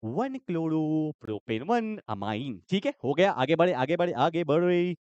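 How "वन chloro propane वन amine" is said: slow answer readout. Shown as a demonstration. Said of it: "बोलो ना".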